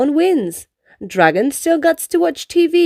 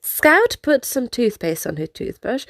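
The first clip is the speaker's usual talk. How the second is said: plain reading voice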